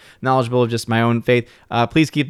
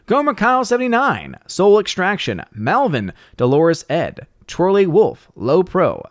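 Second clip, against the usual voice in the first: higher-pitched announcer with swooping names; shout-out roll call; 7 semitones higher than usual. Acted. Host thanks channel members by name, announcer roll call. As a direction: sing out each name like an announcer.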